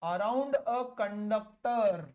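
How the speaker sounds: very slow, stretched-out words; measured classroom dictation; dictating notes. Real, not acted. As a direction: draw out each word for note-takers.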